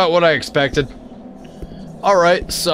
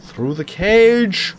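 slow, stretched, cautious; slow drawn out; 4 semitones higher, much slower than usual. Real, not acted. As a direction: drag it out carefully. Creeping through the cage.

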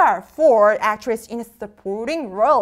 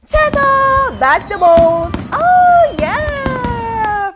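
high, loud topic announcement; excited reveal; 10 semitones higher, 6 dB louder, slower than usual. Real, not acted. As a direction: burst out with a celebratory cheer.

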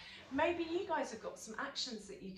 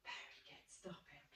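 whispered praise; 21 dB quieter than usual. Acted. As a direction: breathe the praise softly.

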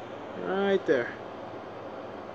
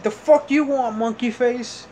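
higher-pitched retold challenge; confrontational retort; 6 semitones higher, slower than usual. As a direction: throw back a mock taunt at a bully. Retort to childhood bully.

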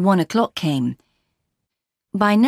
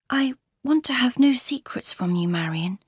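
lightly voiced troubled young wife; confiding Laura; 7 semitones higher than usual. Laura confides in Marion about her marriage, troubled and confiding.

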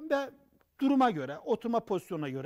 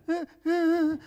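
short bright laugh, higher; demo laugh; 7 semitones higher, 4 dB louder than usual. Shown as a demonstration. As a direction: laugh to show a happy person. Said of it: "aynen şöyle". (gülerek gösterim)

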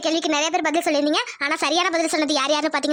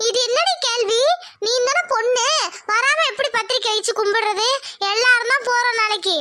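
higher, mock-exasperated mother; scolding mother; 6 semitones higher than usual. Mother chiding daughter, exasperated. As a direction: chide the silly question.

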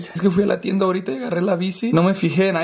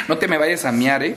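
normal talking voice

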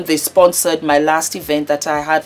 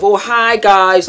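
slow, bright mock greeting, louder; mock a staged hello; fake host greeting; 4 semitones higher, 7 dB louder, slower than usual. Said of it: "it's not going to be that kind of".

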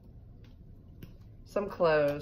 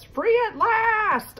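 joyful crayon; 7 dB louder, slower than usual. Acted. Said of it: "Silver Crayon says".

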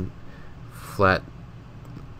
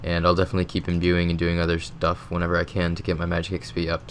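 normal talking voice

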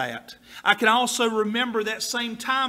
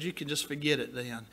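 low quiet retort; 8 semitones lower, 9 dB quieter than usual. Retold or imitated. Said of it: "My mama said".

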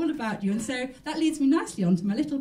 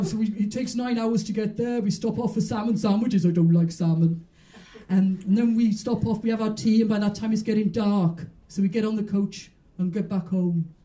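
Welsh grandmother impression; 3 semitones lower, 5 dB louder than usual. A comic doing her Welsh gran for the crowd, lightly Welsh. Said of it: "this is what my grandmother used to say".

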